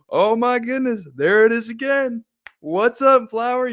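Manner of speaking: very high, loud, gleeful greeting; retold giddy delight. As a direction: squeal hello like to an old friend. Retold delight at seeing flowers again.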